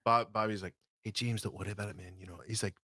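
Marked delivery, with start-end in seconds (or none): 1.0-2.2 a hint of Bobby Carlyle's Scots; Scottish accent. Comforting a nervous actor, a light Scottish accent. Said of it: "your Scottish, Bobby Carlyle accent".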